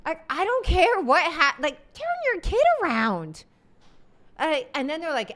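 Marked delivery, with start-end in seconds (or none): 0.0-3.4 high, pushed outburst; real annoyance at a parent who won't act; exasperated. Real, not acted. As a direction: throw up your hands at it. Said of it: "exasperated outburst".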